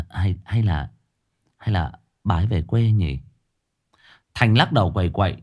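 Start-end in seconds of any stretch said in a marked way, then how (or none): 0.1-3.2 forced smile. A narrator's reading. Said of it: "cố gượng cười đáp".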